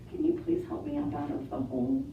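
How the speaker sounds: soft plea. Acted. Old man begging the mice for help, soft plea. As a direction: ask gently for help.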